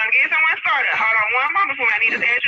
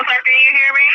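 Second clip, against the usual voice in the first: raised voice, checking the line; real, louder call for attention; 6 dB louder than usual. Real, not acted. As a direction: call loudly to someone not answering the phone. Dispatcher calling to unresponsive caller.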